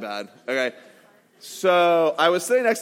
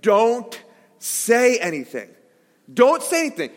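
slow, higher pleading to himself; urgent inner voice; 5 semitones higher than usual. Retold or imitated. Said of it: "That's the internal dialogue".

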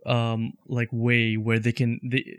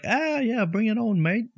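laid-back bad Australian accent, pitched up; horrible Australian accent; 9 semitones higher than usual. Imitating a chill Hugh Jackman on the haircut, in a hammed-up Australian accent. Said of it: "that was my horrible Australian accent".

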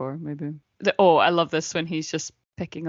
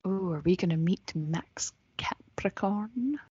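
slow, softer whisper of suspense; mock hushed anticipation; 7 dB quieter, slower than usual.

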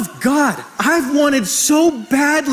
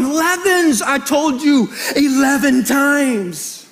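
Jesus as coach; 3 semitones higher, much slower than usual. Retold or imitated. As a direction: call out like a coach, then draw the reminder out. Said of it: "it's like Jesus is going".

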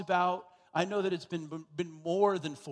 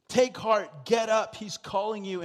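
louder, higher preaching cry; preacher urging the congregation on, loud and rising; building exhortation; 4 semitones higher, 5 dB louder than usual. Acted. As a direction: rouse the room to stand up. Refrain builds to full force.

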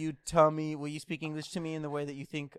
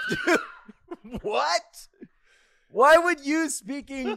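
incredulous retold outburst; 12 semitones higher, 10 dB louder, much slower than usual. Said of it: "And I'm like".

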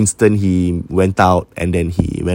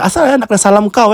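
much higher, quick, incredulous; indignant retort; 13 semitones higher, 4 dB louder, faster than usual. Retold or imitated. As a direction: fire back in disbelief at the odd request. Sister indignant at odd request.

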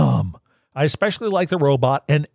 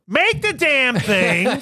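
exasperated; 9 semitones higher, much slower than usual. Real, not acted. Exasperated about screenplays.

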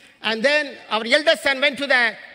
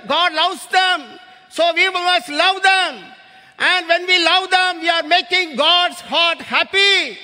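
slow, loud, higher preaching climax; preaching at full force; 5 semitones higher, 4 dB louder, slower than usual. Acted. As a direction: build the appeal and lift it to a shout. Climax of missions appeal.

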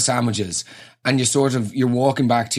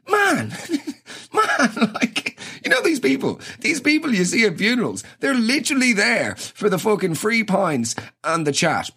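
laughing; 9 semitones higher than usual. Real, not acted. Laughing rant about freeloaders.

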